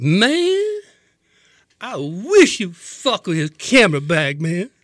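hammed-up slurred threat; belligerent drunk. Shown as a demonstration. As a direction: goad the bouncer like a wasted tough guy. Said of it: "you got to see me when I'm doing this".

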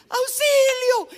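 very high, slow scream for rescue; shouting for help. Acted. Drowning man crying to anyone near, shouting for help. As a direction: stretch out a loud, hammed-up cry. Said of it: "él empezó a gritar".

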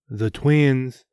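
usual speaking voice

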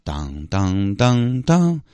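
slow sung ding-dong chime; imitated chime. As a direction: hum the airport chime slowly. Mimics PA chime.

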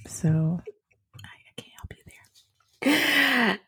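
whispered joking aside. Real, not acted. To her co-host, teasing, a laughing whisper.

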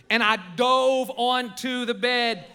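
normal talking voice

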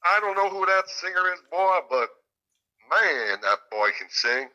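high, hammed-up Southern accent; [name]'s super Southern accent. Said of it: "super Southern accent".

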